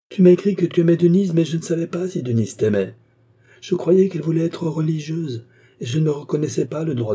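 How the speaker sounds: plus haut et plus fort; explication véhémente. A narrator's reading. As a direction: insiste avec vivacité. Marquis explaining heatedly. (le marquis explique avec vivacité)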